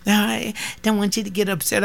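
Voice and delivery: high, prissy mock of a call-centre voice; mocking the Citibank caller, high voice; mocking bank lady. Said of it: "that silly lady called me from Citibank".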